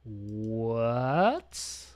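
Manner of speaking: long drawn-out low, soft question rising at the end; baffled. Real, not acted. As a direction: stretch it out, puzzled, swooping upward. Puzzled by a notification.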